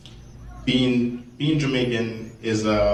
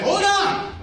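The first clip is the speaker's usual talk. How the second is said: high-pitched, hammed-up plea to an attacker; mock panicked protest; much slower than usual. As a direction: stop him in pretend alarm, stretching the last words. Pleading with imagined Klan attacker.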